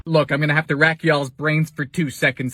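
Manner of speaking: ordinary talk, nothing marked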